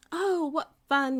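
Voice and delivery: high and loud, slowed with joy; a sliding bear, delighted. A narrator's reading. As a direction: squeal with fun, smiling. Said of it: "he said with a smile".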